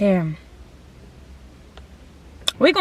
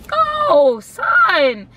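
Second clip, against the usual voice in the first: high, louder mocking take on the friend; 8 semitones higher, 3 dB louder than usual. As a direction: squeak the friend's dismissive brush-off. Friend brushes her off.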